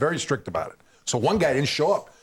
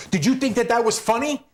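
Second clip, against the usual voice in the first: raised, sneering challenge, higher pitch; mock scornful question; 8 semitones higher than usual. Rob Bartlett to young comic after the set, scornful challenge. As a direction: taunt him after he bombed. Comic confronting him backstage.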